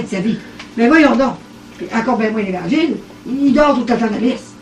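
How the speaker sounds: ordinary talk, nothing marked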